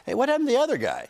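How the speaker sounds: quick, high-pitched puzzled question; questioning elf. Retold or imitated. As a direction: pipe up fast as a baffled onlooker. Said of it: "why the elves, when I showed up at the North Pole, didn't go".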